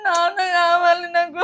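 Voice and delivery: slow, loud sobbing plea; crying for father. Acted. Pregnant wife to husband, slow loud sobbing plea. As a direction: wail that she wants her father. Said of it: "నువ్వు ఏడవకు first".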